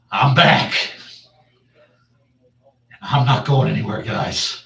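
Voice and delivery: loud, rising exuberant proclamation; triumphant announcement. Acted. Returned halfling announcing himself to friends and demanding food, exuberant shout. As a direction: belt it out to the whole party.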